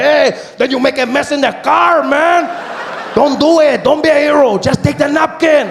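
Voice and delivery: very high, loud pleading shout; a high, loud yell to take the napkin; taco guy. Retold or imitated. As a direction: beg him like a worried vendor. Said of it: "He said".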